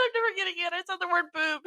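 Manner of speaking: high, tearful-sounding whine; mock crying. Student confessing after the interview, mock crying. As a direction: whimper it as a comic retold breakdown. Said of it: "I started crying".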